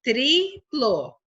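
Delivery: TRIPLO split into tri and plo; separated syllables in "triplo". Shown as a demonstration. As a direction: say "triplo" in two drawn-out beats. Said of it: "cada sílaba".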